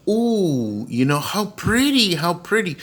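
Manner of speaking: high, cooing delight; dreamy, high-pitched gushing; retold admiration. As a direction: re-enact a child's awe at something pretty. Retold child admiring dolls.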